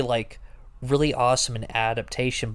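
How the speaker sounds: usual speaking voice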